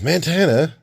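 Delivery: high, loud surprised call; surprised recognition. Retold or imitated. He-Man seeing the spy, high surprised call. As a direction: recognise him with a start. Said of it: "He-Man says".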